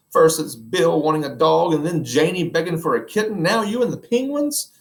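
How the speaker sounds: fed up with the family's pet wishes; exasperated wife. Acted. Wife scolds about pets.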